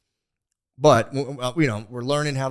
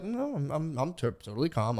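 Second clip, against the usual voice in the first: acting out forced calm, softer; feigned calm; 4 semitones higher, 5 dB quieter than usual. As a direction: pretend to be totally calm. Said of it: "I'm fucking freaking out inside".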